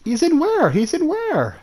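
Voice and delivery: very high incredulous question, falling at the end; high-pitched disbelief. Real, not acted. Player hearing someone hidden nearby, high-pitched disbelief. Startled by voice in game.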